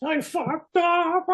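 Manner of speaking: high drawn-out wail, hammed up; exaggerated weepy howl; mock crying. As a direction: imitate a man bawling, loud and stretched out. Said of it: "he's crying and shit".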